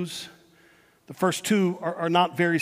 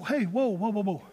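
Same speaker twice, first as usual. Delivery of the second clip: softer, gentle calming voice; slowed-down parent; 8 dB quieter than usual. Acted. Parent slowing down to ask a stressed kid, gentle and soft. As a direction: ease the kid down, tender and unhurried. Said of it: "slow down and say".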